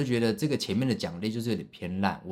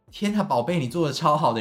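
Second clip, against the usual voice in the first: high, loud gushing praise; excited praise; 7 semitones higher, 7 dB louder than usual. Retold or imitated. Mom cheering her little son, high and loud. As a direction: gush over the child, thrilled.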